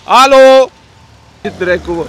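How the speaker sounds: very loud, high, drawn-out yell; sharp road-rage call; real shout. Real, not acted. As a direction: holler to get a driver's attention. Calling out angrily in traffic.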